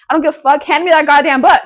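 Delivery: loud, high, fast demand to hand over the book. Pretend. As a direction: shout it like you're grabbing the book from them. Demanding the book of her life.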